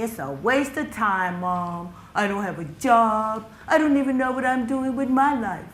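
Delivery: a son's mild, rising protest; frustrated son. Retold or imitated.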